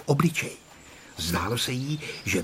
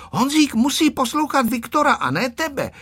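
high, strict scolding; high-pitched little disciplinarian; stern voice; 13 semitones higher than usual. A narrator's reading. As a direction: tell off firmly in a high voice. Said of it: "řekla přísným hlasem Tereska".

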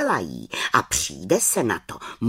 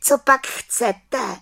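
high, slow little witch voice; little witch asking; 11 semitones higher, 4 dB louder, much slower than usual. A narrator's reading. Little witch asks the lost children what they want, high and slow.

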